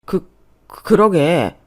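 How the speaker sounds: slow, loud, halting stutter; startled stammer. A narrator's reading. Husband shocked at his own slap, stammering. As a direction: stumble on the first syllable. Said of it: "말까지 더듬으며 그러는 거예요".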